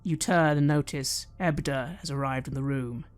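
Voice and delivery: normal talking voice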